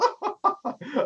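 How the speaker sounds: high, staccato put-on laugh; Lady Pomp's mocking laugh. Lady Pomp laughs at Madame Scowl, high mocking laugh. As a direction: laugh at her in a high character voice. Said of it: "just kind of laughs at her".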